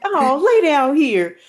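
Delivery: soothing grandma. Retold or imitated. Nana telling the sick child to lie down, soothing. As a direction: settle the child down gently.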